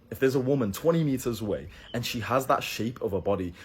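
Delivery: ordinary delivery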